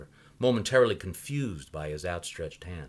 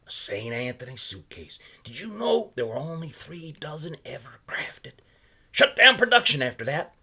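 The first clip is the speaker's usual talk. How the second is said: put-on a higher, louder voice for the odd old salesman; old man pitching his wares, higher and louder; 8 semitones higher, 8 dB louder than usual. A narrator's reading. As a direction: play the chatty old knot peddler. Salesman's voice in read story.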